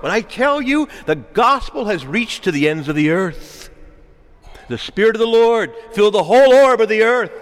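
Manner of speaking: high, building proclamation, ending in a loud peak; impassioned preaching. Real, not acted. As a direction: proclaim it to the whole church at full force.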